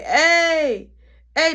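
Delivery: high, loud call for attention; yelling. Retold or imitated. Freezing in the cell, yelling to be heard. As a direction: holler for the guards, acting it out. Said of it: "that's you yelling?".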